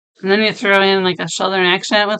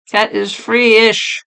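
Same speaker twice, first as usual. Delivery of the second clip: ordinary talk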